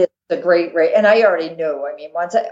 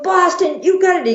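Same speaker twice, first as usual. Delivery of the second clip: high-pitched retold cheer; cheering supporter; 12 semitones higher than usual. Charity teammate cheering on the Boston runner, excited. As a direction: call it out high like a thrilled fan. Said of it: "one of the guys says to me".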